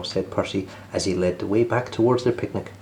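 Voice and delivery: ordinary delivery, nothing marked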